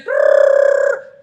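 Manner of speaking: loud, high trilled ring; shrilling bell noise; imitated school bell. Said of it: "begitu bunyi bel".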